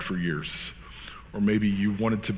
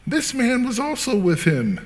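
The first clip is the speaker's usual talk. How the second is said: put-on high, louder servant girl's accusation; accusing; 13 semitones higher, 3 dB louder than usual. A narrator's reading. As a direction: point him out in a raised, high pitch. Servant girl accuses Peter in scripture.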